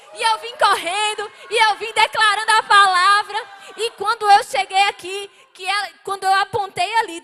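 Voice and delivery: loud, high fervent preaching; preaching at full force. Real, not acted. Preacher to the congregation, fervent loud preaching.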